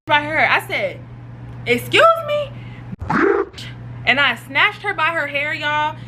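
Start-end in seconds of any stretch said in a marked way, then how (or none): 1.7-2.5 very high-pitched, incredulous question; mock affronted. Reacting to friend's insult, mock affronted high pitch. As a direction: squeal it as an offended comeback. Acted offended reaction before fight.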